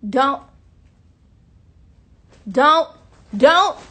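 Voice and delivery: rising shout of don't. Real, not acted. Shouting at someone to stop.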